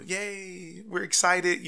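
high-pitched token yay; mock celebration, going through the motions; lightly acted routine excitement; half-hearted cheer. Imitates routine cheer for fourth kid.

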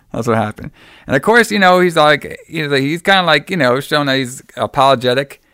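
ordinary talk, nothing marked

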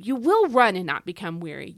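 "will" punched loud, then easing off; loud, insistent "will" as a promise; stress on WILL. Shown as a demonstration. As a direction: drive home the word "will". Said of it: "all the times in this verse where God says will".

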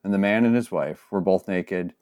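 scripture reading. Acted. Pastor reading Genesis aloud to the congregation, solemn and measured. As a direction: read the passage with solemn care.

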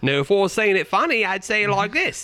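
high-pitched, hammed-up Cockney accent; mock Cockney. Shown as a demonstration. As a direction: put on a silly Cockney voice. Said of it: "Don't go Cockney".

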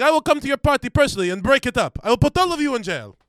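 high, loud furious threat; angry yelling. Retold or imitated. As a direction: scream it at the screen. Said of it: "they're just screaming at a computer".

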